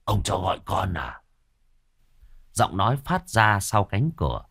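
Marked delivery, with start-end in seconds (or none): 0.0-1.2 eerie voice behind the door; a creepy servant's answer. Acted. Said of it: "âm thanh tự nhiên nghe rờn rợn".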